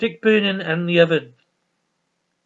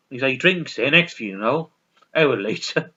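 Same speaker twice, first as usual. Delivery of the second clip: normal talking voice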